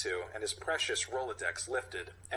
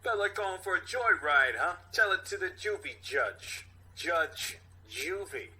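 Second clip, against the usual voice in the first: louder, higher cop voice; tough cop; 6 semitones higher, 3 dB louder than usual. Acted. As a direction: sneer at the kid like a cop. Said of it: "Brew then responds".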